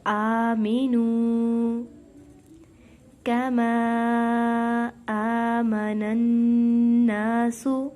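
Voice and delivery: slow, loud melodic tajweed chant; Quran recitation. Acted. Teacher recites for students in slow chant. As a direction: draw every syllable out like a reciter.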